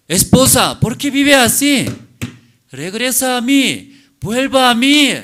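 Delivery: loud, high, urgent pleading; pleading husband. Acted. Preacher voicing the husband calling his wife home, loud plea. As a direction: beg her to come home at full voice.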